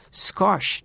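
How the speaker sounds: スコッシュ as English "skosh"; 英語での発音. Shown as a demonstration. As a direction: end スコッシュ on a bare "sh", no final vowel. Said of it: "スコッシュっていうらしいよね". (スコッシュの語尾を「シュ」で止める)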